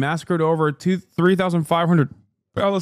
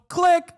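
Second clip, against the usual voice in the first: sharp higher mouth click; click sound; 11 semitones higher than usual. Retold or imitated. Streamer miming the silencing button, sharp click. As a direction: snap out a little click noise. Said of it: "And then you just go".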